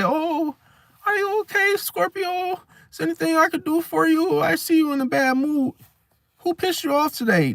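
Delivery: put-on high, cooing baby-talk concern; babying sympathy. Pisces babying a moody Scorpio, high cooing mock sympathy. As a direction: coo at them like a hurt child. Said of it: "Feel baby them and say".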